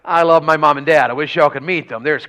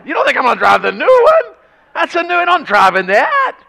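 higher, faster country dad voice; imitating dad, hammed up; 11 semitones higher, 4 dB louder than usual. Dad insisting to his son he'll keep the old truck, high and hammed. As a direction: ham up the stubborn old dad. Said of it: "That was exactly what he said".

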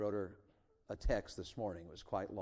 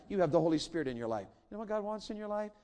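ordinary delivery

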